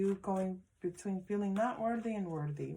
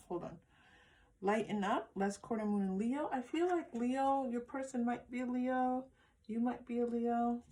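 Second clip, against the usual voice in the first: normal talking voice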